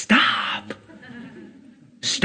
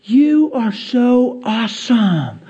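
mock gushing praise; 6 semitones higher, much slower than usual. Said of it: "for them to say".

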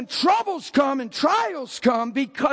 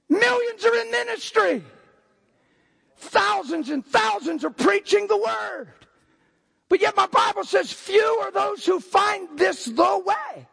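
full-force preaching; 7 semitones higher than usual. Acted. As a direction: preach it out to the whole room.

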